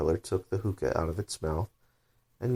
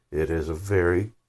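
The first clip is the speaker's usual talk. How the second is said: angry; 4 dB louder, slower than usual. Acted. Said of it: "said the caterpillar angrily".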